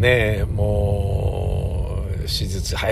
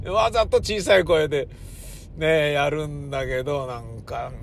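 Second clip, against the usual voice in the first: ordinary delivery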